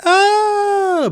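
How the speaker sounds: long, very high held scream; hammed-up high shriek on one note; mock scream. James found in the closet, high mock scream. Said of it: "James is like".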